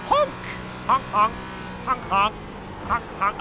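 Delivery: goofy goose honks; silly clown honking. Shown as a demonstration. Imitating swan noise.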